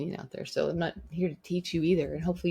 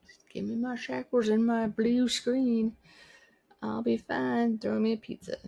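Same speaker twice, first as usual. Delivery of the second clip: drawn-out, higher mock plea; complacent citizen; 6 semitones higher than usual. The host mocks people content with shackles and screens, mock plea. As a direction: put on a high voice, begging lazily for comforts.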